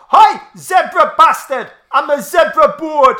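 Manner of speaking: loud, high Geordie shouting; drunken Geordie yell. Acted. Drunk man yelling at a zebra, mock Geordie shout. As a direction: bellow it in comic Geordie, pitched right up. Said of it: "and he's yelled".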